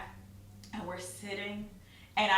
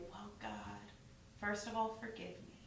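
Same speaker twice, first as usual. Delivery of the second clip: much softer, humble plea; humble prayer; 11 dB quieter than usual. Retold or imitated. Asking God's forgiveness, soft humble prayer. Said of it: "humbly submit myself and say".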